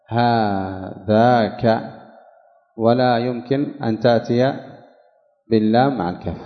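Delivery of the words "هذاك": slow demonstration. Shown as a demonstration. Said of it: "ولك أن تأتي بالكاف وحدها".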